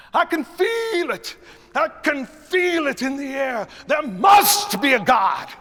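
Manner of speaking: loud, high, slow preacher's cry; preacher shouting. Shown as a demonstration. Conductor plays a preacher calling out to the congregation. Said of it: "we might hear this".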